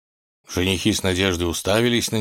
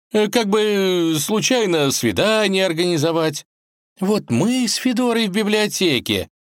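high, wistful, hesitant start; dreamy drawl; 13 semitones higher, 3 dB louder than usual. A narrator's reading. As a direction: drift dreamily through the idea. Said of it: "мечтательно протянул он".

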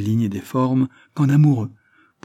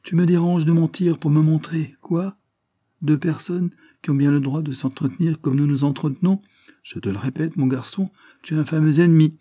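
higher-pitched, cool retort; a controlled, pointed comeback; cold riposte; 6 semitones higher than usual. A narrator's reading. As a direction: answer back coolly, with pitch raised. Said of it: "O'Meara riposta froid".

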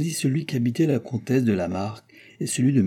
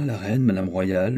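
plain reading voice

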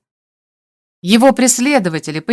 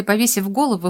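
ordinary delivery, nothing marked

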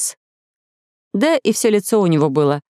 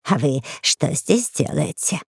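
slow, softer lizard voice; 3 semitones lower, 4 dB quieter, slower than usual. A narrator's reading. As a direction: creature voice, slow and soft. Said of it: "ящерица... спросила".

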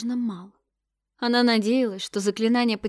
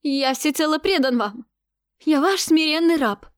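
pleading; 7 semitones higher, 6 dB louder than usual. A narrator's reading. Terrified prefect pleads with god.

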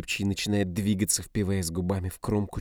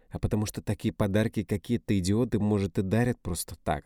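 ordinary delivery

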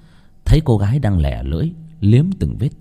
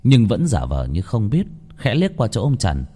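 ordinary delivery

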